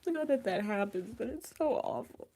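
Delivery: real tearful voice, rising high at the end; crying. Real, not acted. Streamer reacting to a viewer's loss, crying. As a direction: talk through tears. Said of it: "Crying on YouTube is wild".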